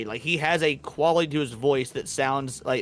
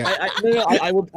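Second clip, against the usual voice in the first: laughing, louder, through the words; 5 semitones higher, 4 dB louder, faster than usual. Real, not acted. To friends, laughing while starting a joke.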